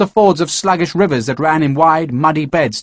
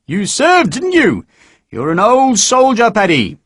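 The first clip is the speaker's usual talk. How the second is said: high, loud knowing jab; needling sergeant; 9 semitones higher, 3 dB louder, slower than usual. Acted. Sergeant needling Harper about serving, high and loud. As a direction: pin him with it.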